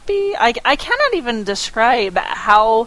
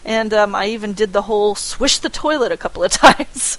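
ordinary talk

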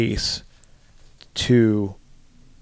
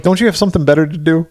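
higher, louder, fast annoyed retort; exasperated snap; 7 semitones higher, 5 dB louder, much faster than usual. Retold or imitated. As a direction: brush the pesky kid off, irritated. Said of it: "he's like".

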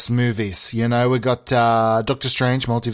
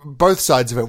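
ordinary delivery

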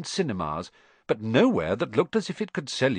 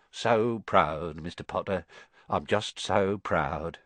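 lower, slow, choked-up pride; tender pride; 5 semitones lower, slower than usual. Acted. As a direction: say it near tears of pride. Another admirer, tearful pride.

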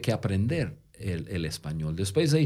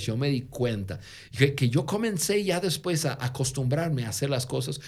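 normal talking voice

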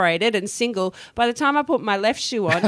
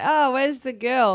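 confused man voice; 5 semitones higher, slower than usual. Retold or imitated.

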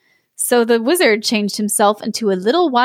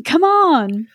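screamed again; 6 semitones higher than usual. A narrator's reading. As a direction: yell again to hurry him. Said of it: "gave him a kick and screamed".

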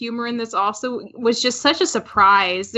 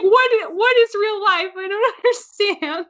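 confused Chihiro voice; 8 semitones higher than usual. Retold or imitated. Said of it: "You're just like".

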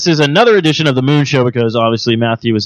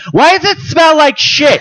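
high, slow yell; mock drunken yell; 14 semitones higher, 3 dB louder, slower than usual. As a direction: yell it like an appalled drunk girl. Retold drunk girl yelling.

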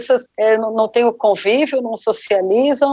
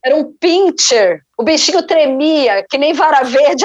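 ordinary talk

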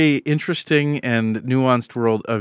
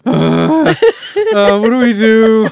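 higher, louder mock panic; hammed-up nervous missionary on a date; returned missionary lost on a first date; awkward panic; 12 semitones higher, 9 dB louder, slower than usual. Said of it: "it's a little bit of that".

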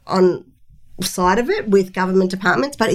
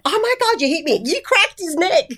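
high, startled cry of disbelief; shocked chiropractor; 9 semitones higher than usual. Retold or imitated. Chiropractor reacting to a mother's slap, in high shock. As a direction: squeal it like you've just been slapped. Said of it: "She looked at me and she went".

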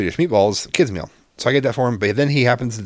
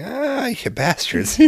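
drawn-out, slow groan of defeat; a high, slow mock curse; mock exasperation; 13 semitones higher, much slower than usual. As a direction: curse them slowly, half-joking. Seeing dessert placed first.

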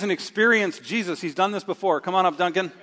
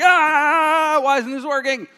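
much higher and louder exasperated cry; frustrated outburst; 8 semitones higher, 7 dB louder, slower than usual. Retold or imitated. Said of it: "I'm like".

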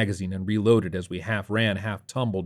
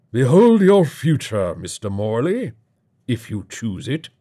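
slow, loud proclamation opening high; grand bunker host; 3 semitones higher, 6 dB louder than usual. Acted. Host offers Morley his future, grandly. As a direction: announce it like a theatrical master of ceremonies.

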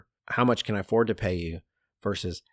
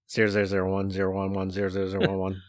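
robot voice; faster than usual. Pretend. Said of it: "I'd be binary".

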